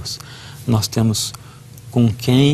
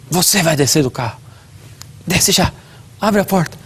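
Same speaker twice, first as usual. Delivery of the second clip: stern father order; 5 semitones higher than usual. Retold or imitated. Father forcing son out of car.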